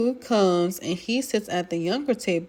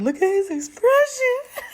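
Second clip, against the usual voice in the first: high, louder gleeful tease; teasing squeal; 4 dB louder than usual. Retold or imitated. Friend teasing Plee about losing her man, high squeal. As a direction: crow over the rival's face.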